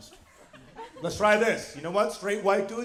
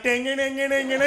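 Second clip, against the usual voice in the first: sing-song gibberish Indian mimicry; higher caricature of an Indian accent; mock Indian accent; 3 semitones higher than usual. British man mocking an Indian greeting, gibberish accent. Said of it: "if I said hi to him and he was like".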